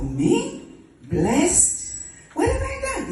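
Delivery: high, incredulous questions; taken aback, voice leaping up; startled disbelief. Acted. Mary reacting to the angel's greeting, startled disbelief.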